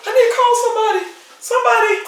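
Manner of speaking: very high, excited cry; overwhelmed excitement. Real, not acted. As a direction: call out, bursting with news. Overwhelmed by the engagement news.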